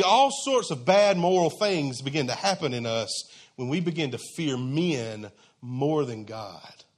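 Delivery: ordinary talk, nothing marked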